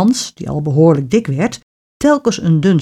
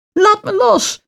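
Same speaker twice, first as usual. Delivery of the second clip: shriek. A narrator's reading. Hans seized by the witch, high storybook shriek. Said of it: "gilde Hans".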